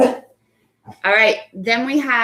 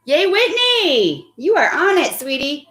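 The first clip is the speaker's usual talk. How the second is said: excited cheer; 7 semitones higher than usual. Real, not acted. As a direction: cheer the pupil on with real delight. Cheering a pupil's correct answer.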